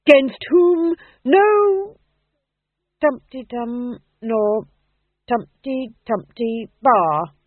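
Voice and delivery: slow, loud start, then filling gaps; uncertain declaiming. A narrator's reading. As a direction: bluff through forgotten verse. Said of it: "he said uncertainly".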